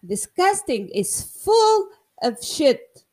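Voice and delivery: high, slow mocking imitation of the doctor. Doctor quoted, voice jumps high.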